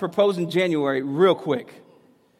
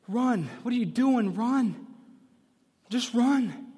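urgent plea; 7 semitones higher, slower than usual. Retold or imitated. Acts out inner warning to the bride.

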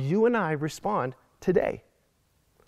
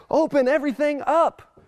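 higher mock open-it-up demand; 8 semitones higher than usual. Pastor mimics someone demanding reopening, higher. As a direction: fire it back like the other side. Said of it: "other people are like".

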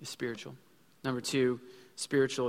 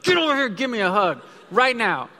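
much higher, louder, commanding dad order; 8 semitones higher, 10 dB louder than usual. Retold or imitated. Dad orders his daughter to give him a hug, commanding. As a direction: bark it like a stern father. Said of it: "I have to use my dad voice".

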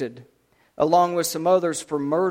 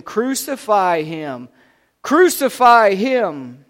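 drawn-out shout, building louder and higher; 3 semitones higher, 8 dB louder, slower than usual. A narrator's reading. Crowd demanding an execution from Pilate, shouting. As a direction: start firm, then let the slow shout swell. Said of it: "They all shouted again".

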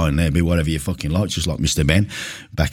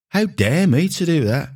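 mock outrage; 12 semitones higher than usual. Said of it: "repeated mock outrage".

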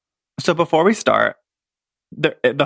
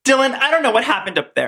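Brenda reenactment; 8 semitones higher, faster than usual. Acted. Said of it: "me and Robert are gonna reenact it".

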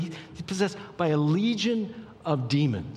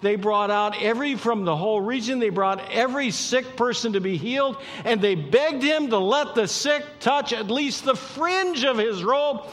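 impassioned preacher gathering height and force; building, full-force preaching; 7 semitones higher, 5 dB louder than usual. Real, not acted. Preacher telling of the sick brought to Jesus, building to full force.

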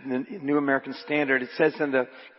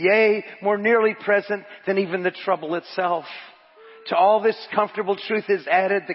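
raised, emphatic sermon delivery; preaching; 6 semitones higher, 7 dB louder than usual. Acted. Preacher reads a commentary to the congregation, raised emphatic preaching. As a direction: preach the quotation with building conviction.

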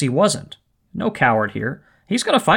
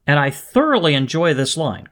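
ordinary delivery

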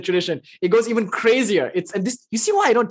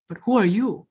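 quiet question; 3 semitones higher than usual. Retold or imitated. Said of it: "fourth answer is stunning".

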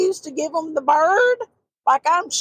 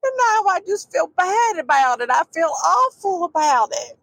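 grandmother crying; 7 semitones higher than usual. Retold or imitated. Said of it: "she said".

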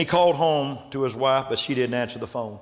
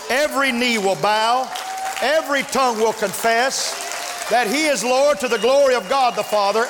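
higher, louder, building preacher's cry; preaching at full force; 10 semitones higher, 4 dB louder than usual. Acted. Preacher firing up his congregation in full force. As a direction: lift the church with rising fervor.

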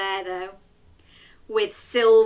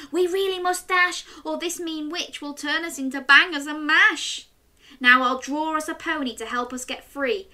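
put-on little girl voice; 7 semitones higher, faster than usual. Chloe speaks to brother.

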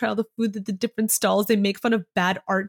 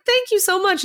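high-pitched, grateful gush; gushing thanks; 9 semitones higher than usual. Retold or imitated. Retold friend thanking the gift-giver, mock gushing. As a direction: gush it like a delighted character.

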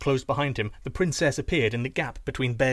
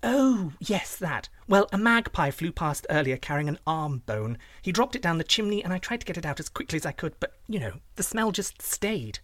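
high-pitched princess explaining away; fibbing princess; 5 semitones higher than usual. Acted. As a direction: spin an excuse in a higher, airy princess voice.

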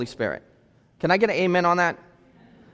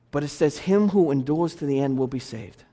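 gentle reassurance; 6 semitones lower, 6 dB quieter than usual. Real, not acted. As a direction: lower and softer, tender promise. Reassuring scripture quote, softer.

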